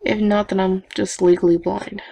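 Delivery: usual speaking voice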